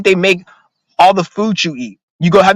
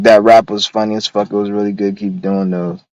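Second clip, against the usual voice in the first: reading a fan comment; 8 semitones lower than usual. Retold or imitated. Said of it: "He's like".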